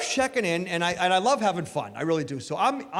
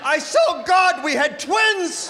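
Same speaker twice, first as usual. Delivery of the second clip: horrified shout; 8 semitones higher, slower than usual. Retold or imitated. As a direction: bellow in mock horror.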